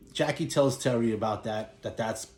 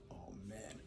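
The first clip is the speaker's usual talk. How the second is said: muttered under the breath, much softer; dismayed under breath; 2 semitones lower, 22 dB quieter than usual. Retold or imitated. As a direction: let it slip out barely audible, dismayed. Seeing faked-death partner at funeral.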